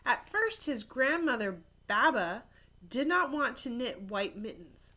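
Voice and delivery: put-on lively storybook narration for kids; animated read-aloud; reading to children. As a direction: tell it like a picture book at story time. Reads picture book aloud.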